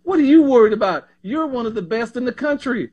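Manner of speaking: lightly voiced country coach; easy Southern coach voice. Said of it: "just like in that country voice".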